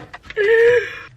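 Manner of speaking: high, loud gasp through tears; sharp emotional intake while crying; real high-pitched crying gasp; tearful gasp. Real, not acted. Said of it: "why am I crying".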